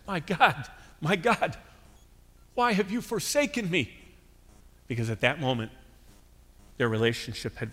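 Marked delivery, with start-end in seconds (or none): 0.1-3.9 a hint of an anguished cry, pitched higher; crying out to God. Preacher voicing Jesus on the cross, mild anguished cry. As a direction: cry out upward in anguish, restrained. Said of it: "he cried out to God and said".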